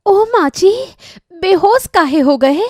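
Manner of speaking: high, loud panicked call; alarmed. Acted. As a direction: cry out in shock at the fallen body. Bride finds mother-in-law fainted.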